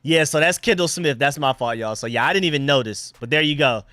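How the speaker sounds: ordinary talk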